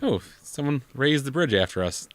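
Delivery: ordinary talk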